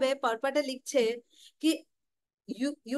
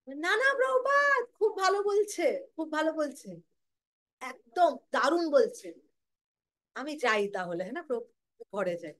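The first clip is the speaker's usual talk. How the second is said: flustered devotee backing out; 3 semitones higher than usual. Retold or imitated. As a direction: squeak out the excuses then slink away. Devotee backs out nervously.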